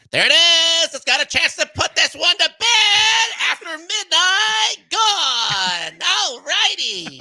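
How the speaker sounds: very high, loud home-run call, slow; very high, shouted mock home-run call; Jenny Kavnar impression. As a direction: shout the homer like a broadcaster. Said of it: "your impression of Jenny Kavnar".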